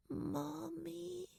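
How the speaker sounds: put-on high, distant, far-away little girl; a small dazed voice drifting out; faint child voice. A narrator's reading. Little girl waking, calling faintly to mommy.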